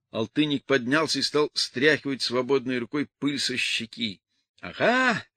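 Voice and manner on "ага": high, loud triumphant crow; gloating shout. A narrator's reading. Woman gloats at the runaway husband, loud and high. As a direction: crow it out, caught him at last. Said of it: "злорадно закричала Людмила".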